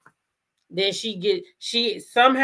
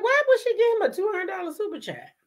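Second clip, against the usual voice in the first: mock incredulous viewer; 12 semitones higher, faster than usual. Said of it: "you always like".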